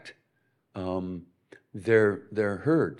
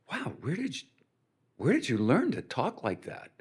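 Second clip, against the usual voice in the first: retold amazement; 3 semitones higher, 6 dB quieter than usual. Retold amazed reaction to friend.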